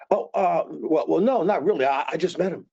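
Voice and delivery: high, stammering backpedal; flustered denial. Shown as a demonstration. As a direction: hurry to deny knowing him. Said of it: "you hear that response?".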